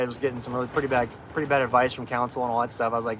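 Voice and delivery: normal talking voice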